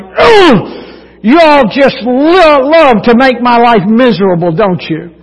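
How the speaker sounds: hammed-up exasperated teen groan and complaint; teen outburst. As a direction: imitate a fed-up daughter stomping her feet. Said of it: "She stomped her feet".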